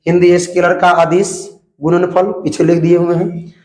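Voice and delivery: usual speaking voice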